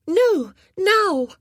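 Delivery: put-on high, loud little boy insisting; stubborn boy. A narrator's reading. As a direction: dig in like a stubborn child.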